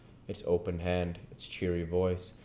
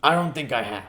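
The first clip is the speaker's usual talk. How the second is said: put-on higher, louder old man; Scrooge voice; 6 semitones higher, 7 dB louder, faster than usual. Scrooge replies to the ghost, higher and louder. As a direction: answer carefully as Scrooge. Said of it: "said Scrooge".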